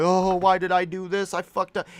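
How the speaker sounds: high, hammed-up self-pitying wail; mock wallowing regret. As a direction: moan it in mock despair. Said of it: "wallowing in your own regret".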